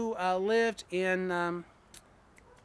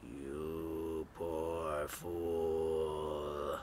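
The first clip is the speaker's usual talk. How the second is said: much lower, very slow unearthly voice; deep hollow voice; 10 semitones lower, much slower than usual. Acted. The thing in the sepulchre speaking to the narrator, deep hollow voice. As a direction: drag it out deep and hollow from the tomb. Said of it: "the voice was deep, hollow".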